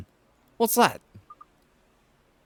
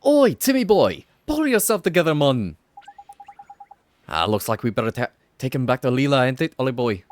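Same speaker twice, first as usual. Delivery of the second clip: Welsh Drippy shouting; 6 dB louder than usual. Acted. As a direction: bark it at the cat in a Welsh lilt. Drippy scolds the cat.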